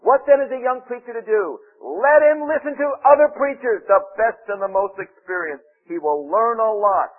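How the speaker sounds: loud, high reading of a quote; emphatic quoted advice. Retold or imitated. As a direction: read it out like a rousing proclamation. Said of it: "Here's what he says".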